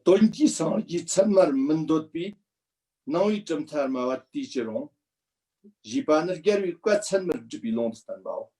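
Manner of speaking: reads the Tibetan text out in steady recitation; light Tibetan text recitation; scripture recitation. Acted. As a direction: intone the Tibetan verse lightly, like reading scripture aloud.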